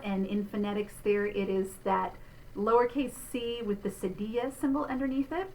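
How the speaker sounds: normal talking voice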